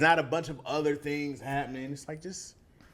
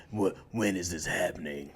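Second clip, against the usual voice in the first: gruff hero; 7 semitones lower than usual. Acted. Mocking Batman and Blade's gruff hero voice, gruffly. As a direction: growl it low like a brooding superhero. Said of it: "heroes with the gruff voice".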